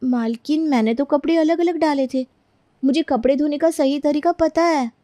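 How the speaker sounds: high-pitched, pleading defence; meek servant protesting. Acted. Maid defending her washing to mistress, pleading. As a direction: protest your innocence politely.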